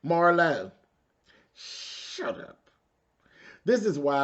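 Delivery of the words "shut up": muttered shut up. Retold or imitated. Telling Marlo off.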